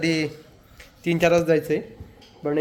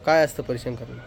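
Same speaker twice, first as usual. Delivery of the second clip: ordinary talk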